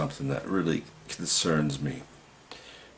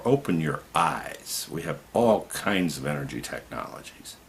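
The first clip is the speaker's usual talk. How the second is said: ordinary talk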